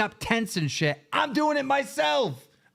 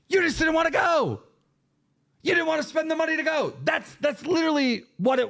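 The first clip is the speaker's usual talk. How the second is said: angry accusation; 6 semitones higher, faster than usual. Real, not acted. Calling out Tommy angrily.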